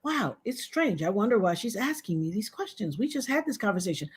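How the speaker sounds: soft, mock-puzzled musing; hushed, passive little ponder; meek wondering. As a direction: imitate someone too polite to object. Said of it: "sit on the phone and be like".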